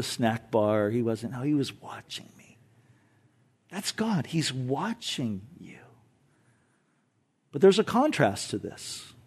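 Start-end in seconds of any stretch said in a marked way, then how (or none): 3.7-5.8 slow, hushed assurance; intimate whisper. Real, not acted. Preacher assuring the congregation, hushed and slow. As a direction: lean in and whisper it.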